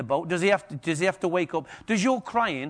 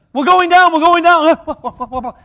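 hammed-up panicked yell, much higher and louder; mock panic; 11 semitones higher, 14 dB louder, much slower than usual. Crewmate waking Jesus in the night, mock panicked yell. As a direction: wail it like the ship is going under, half laughing.

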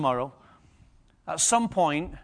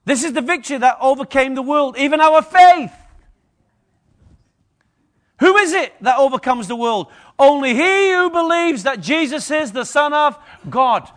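loud ringing scripture proclamation, higher and louder; preaching at full force; 8 semitones higher, 10 dB louder than usual. Acted. Preacher proclaiming scripture to congregation, full force. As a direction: declare it to the whole church like a trumpet call.

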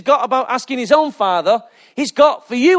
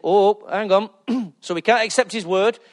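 ordinary talk

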